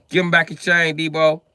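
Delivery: loud, high demand. Acted. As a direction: call the bully out loudly. Acted movie line, American version.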